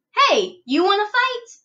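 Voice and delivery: high, loud challenge; grouchy. A narrator's reading. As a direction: shout up at the flipper. Grouchy ladybug challenges flipper.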